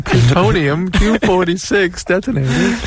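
high, slow cartoon Martian voice; cartoon alien voice. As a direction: play the little cartoon alien. Riffs on the Bugs Bunny alien.

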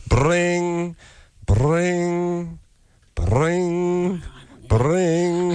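high, sustained, evenly repeated rings; imitated phone ring. Host cueing actress to answer, mimicked rings. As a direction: make the sound of a phone ringing.

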